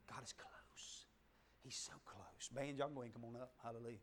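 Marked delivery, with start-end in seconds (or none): 0.1-2.5 unvoiced close whisper. Shown as a demonstration. Preacher whispering how close God is. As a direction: breathe it right next to the ear.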